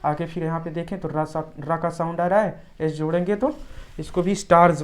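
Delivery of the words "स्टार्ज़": "स्टार्स" ending in a voiced z. Shown as a demonstration. Teacher showing the plural, "स्टार्स" with a z ending. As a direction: say "स्टार्स" as starz, louder, with a buzzing z. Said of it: "इससे जा का sound निकलेगा".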